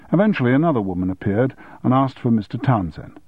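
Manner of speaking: usual speaking voice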